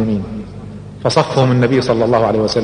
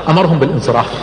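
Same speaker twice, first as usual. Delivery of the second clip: ordinary delivery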